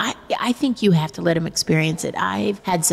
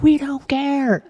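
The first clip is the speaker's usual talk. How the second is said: put-on bored kids; 7 semitones higher, 3 dB louder, much slower than usual. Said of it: "they're like".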